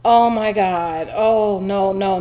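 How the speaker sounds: slow, whimpering dread at the needle; about to cry. Retold or imitated. Patient dreading the needle, near tears. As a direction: near tears, drawing out each word. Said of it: "I'm about to cry".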